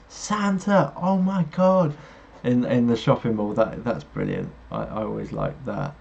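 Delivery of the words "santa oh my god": high, slow, awestruck Buddy the Elf; Elf's Buddy. Retold or imitated. Host quoting Elf's Buddy meeting Santa, high and slow, awestruck. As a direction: gasp it out like a starstruck overgrown elf. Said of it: "Will Ferrell's character goes".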